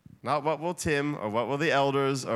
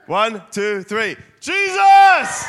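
louder, higher, all-out shout of Jesus; passionate shout; 7 semitones higher, 12 dB louder, much slower than usual. Shown as a demonstration. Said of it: "let's go a bit bigger".

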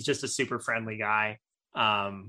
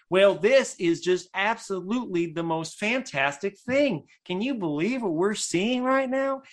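higher, slow, wide-eyed host voice, wowed by everything; Huell Hauser impression; 7 semitones higher, slower than usual. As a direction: gush like an amazed TV host. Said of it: "he would just act really friendly and be like".

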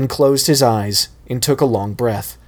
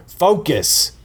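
higher, sharp command; shouting; 7 semitones higher than usual. Acted. Sensei shouting at fallen student, sharp and higher. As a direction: snap him back to attention. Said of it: "Johnny shouted".

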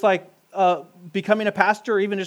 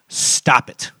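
a short, hissed parental stop it; whispered stern warning like a mom; 4 semitones lower, 3 dB louder than usual. Shown as a demonstration. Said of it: "say with a teacher voice or a mom voice".

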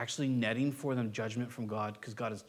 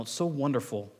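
ordinary talk

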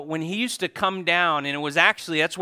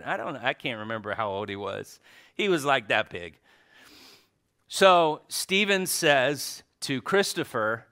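ordinary delivery

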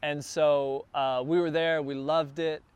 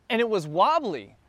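ordinary delivery

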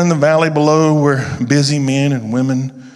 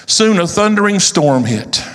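plain reading voice

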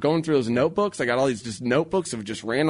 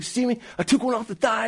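put-on much higher, boyish voice, proud and pumped; excited kid; 11 semitones higher than usual. An excited son brags to his dad about the thigh shot, high boyish voice. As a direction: play the son bragging about his game.

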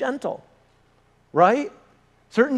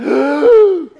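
loud, high gasp; mock collective gasp; 9 semitones higher, 8 dB louder than usual. Whole lobby seeing a baby thrown, mock shocked gasp. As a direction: suck in air in hammed-up shock. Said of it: "everybody just went".

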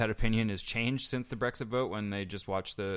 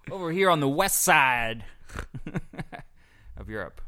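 mock Cockney accent; 4 semitones higher, 6 dB louder than usual. Continuing joke voice.

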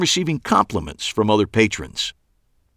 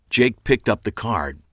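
plain reading voice

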